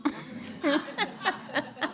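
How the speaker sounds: amused laughter. Real, not acted. Speaker amused by little nephew's retort, laughing. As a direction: laugh at your own story.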